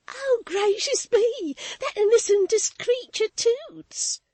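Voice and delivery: high, loud hysterical babble; half laughing, half crying. A narrator's reading. Maid gushing about Toots to Florence, hysterically high. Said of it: "returned Susan hysterically".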